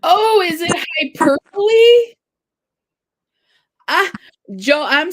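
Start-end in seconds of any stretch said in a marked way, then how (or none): 0.0-2.1 high, loud dawning question; surprised realisation. Real, not acted. Host realising her mistake, loud surprised question. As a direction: exclaim it with hyperbole as "hy-PER-buh-lee".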